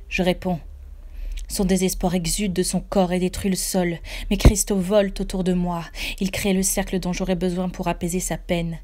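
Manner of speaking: ordinary delivery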